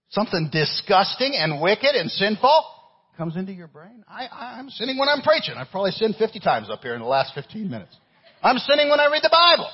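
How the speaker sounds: high-pitched, impassioned confession from the pulpit; full-force preaching. Acted. Preacher confessing his sin to the congregation, full-force preaching. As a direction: cry it out to the church.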